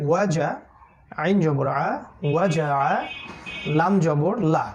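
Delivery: ordinary talk, nothing marked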